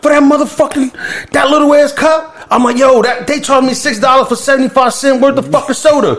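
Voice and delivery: high-pitched, heated rant about the price; outraged rant. Retold or imitated.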